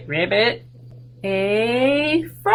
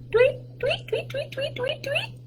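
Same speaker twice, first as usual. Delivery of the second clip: high, soft birdsong tweets; bird tweet; 7 dB quieter than usual. Pretend. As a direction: chirp like a tiny bird, much higher. Imitating bird in rhyme.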